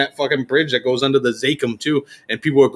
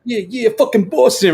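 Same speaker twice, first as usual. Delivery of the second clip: higher-pitched hyped performer; 7 semitones higher than usual. Retold or imitated. Performer kicks off his song, high hyped shout. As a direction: throw it out like a hype man. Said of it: "Hero does this thing".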